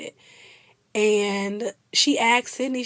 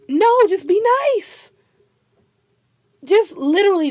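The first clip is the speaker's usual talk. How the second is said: high, loud and fed up; exasperated outburst; 10 semitones higher, 7 dB louder than usual. Real, not acted. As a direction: snap it like you've had enough.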